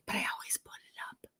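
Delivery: quick soft whisper. Real, not acted. Host confiding about her hair, whispering. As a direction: rush it under your breath.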